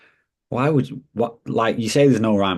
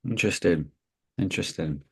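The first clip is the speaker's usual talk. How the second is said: cryptic, withholding remark, falling tone; mysterious; 6 dB quieter than usual. Real, not acted. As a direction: leave it hanging mysteriously, trailing softer. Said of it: "said interesting and left that mysterious".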